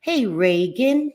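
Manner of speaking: ordinary delivery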